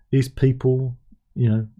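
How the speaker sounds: ordinary delivery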